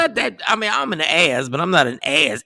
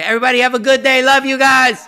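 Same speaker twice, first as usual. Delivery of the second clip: high, loud upbeat goodbye; cheery sign-off; 8 semitones higher, 4 dB louder, faster than usual. Retold or imitated. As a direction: sing out the sign-off like you mean it. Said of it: "You be like".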